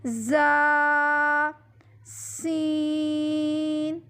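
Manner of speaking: زا with a buzzy z, سین with a whistled s, both sustained; very slow زا، سین, sibilant start and level vowel; whistling sibilants. Shown as a demonstration. Teacher drilling whistle letters, drawn-out زا، سین. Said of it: "ان میں سیٹی کی آواز پیدا کرنی ہے".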